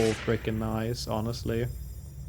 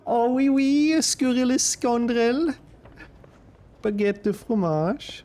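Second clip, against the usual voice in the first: higher, slow hammed-up French accent; mock French accent; 11 semitones higher, slower than usual. Streamer mocking the painting with a hammed-up French accent. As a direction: ham up a bad French accent, drawing out the words. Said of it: "That is a horrible fake accent".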